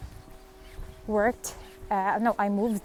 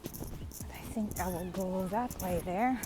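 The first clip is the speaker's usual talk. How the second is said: whispering; 7 dB quieter than usual. Real, not acted. Quiet aside while walking.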